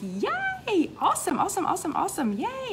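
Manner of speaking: high, squealing cheer of delight; real excited cheering for a friend's win. Real, not acted. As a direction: cheer her on like a fan. Cheering Michelle's 100 pounds.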